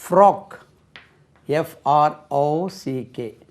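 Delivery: ordinary talk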